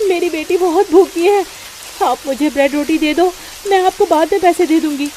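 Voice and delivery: mother begging the shopkeeper for bread; pleading mother. Acted. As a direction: plead for a little food on credit.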